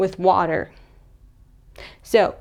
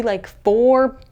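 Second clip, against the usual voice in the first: ordinary talk, nothing marked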